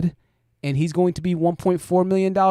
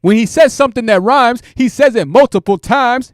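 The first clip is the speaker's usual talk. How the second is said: "says" belted louder and higher, right into the mic; a loud, higher parody of a streamer clipping his mic; mocking mic-blasting yell; 5 semitones higher, 7 dB louder than usual. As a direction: shout it like he's eating the microphone. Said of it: "gets real close to the mic to where the mic starts clipping".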